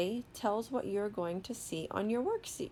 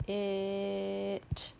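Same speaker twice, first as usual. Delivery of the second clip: "It" with the vowel held long; slow sounding-out. Shown as a demonstration. Teacher models reading for children, "It" drawn out slowly. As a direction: stretch "It" slowly, one level tone. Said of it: "saying the word slowly".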